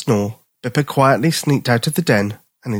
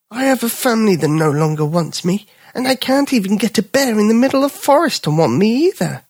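high, sorry-for-himself dog voice; a dog nobody wants, voiced high; lonely little dog; 10 semitones higher than usual. Little dog telling the bear nobody wants him, high and glum.

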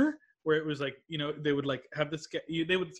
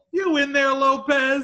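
loud, high holler into a house; mock shout from outside; calling out; 10 semitones higher, 9 dB louder, slower than usual. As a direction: imitate someone yelling for a friend inside. Calling into house, retelling sketch.

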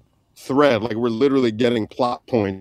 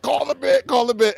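high, pressing imitated whisper-shout; urgent plea; 9 semitones higher than usual. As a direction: urge your guard to act, hammed up. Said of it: "So I'm looking at him like".

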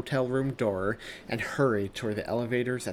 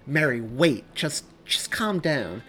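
high, slow, urgent plea; halting, anxious appeal; pleading; 5 semitones higher, 6 dB louder, slower than usual. Acted. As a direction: try to calm her down. Pleading with her not to leave.

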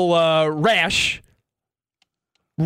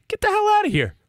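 incredulous outburst; faster than usual. Real, not acted. Dismissing an absurd claim.

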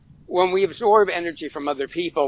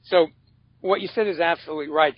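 normal talking voice

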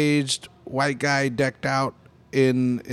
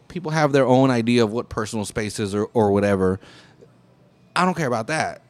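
ordinary talk

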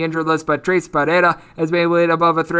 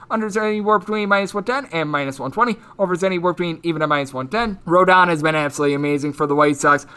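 usual speaking voice

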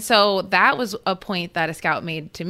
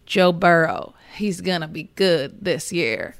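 slow, drawn-out Southern impression of Archie Manning; a light Archie Manning impression, Southern and slow; Archie Manning accent. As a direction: lean into a Louisiana drawl like Archie Manning. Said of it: "That was my Archie Manning accent".

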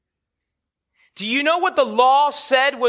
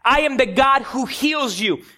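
slow, higher, emphatic proclamation; God; 5 semitones higher, slower than usual. Retold or imitated. God speaks to the children of Israel, slow imitated proclamation. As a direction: proclaim it word by word, with authority. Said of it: "God says to the children of Israel".

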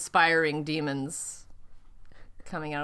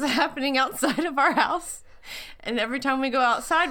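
normal talking voice

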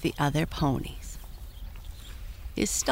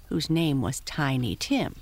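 usual speaking voice

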